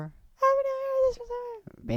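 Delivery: very high, slow, sing-song; falsetto. Shown as a demonstration. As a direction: float it up in falsetto.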